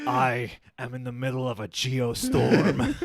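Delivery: put-on, hammy American accent; Gerard Butler. Host quoting another film title, mock actor accent. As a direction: declare it like a Scot faking American. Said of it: "What are some other Gerard?".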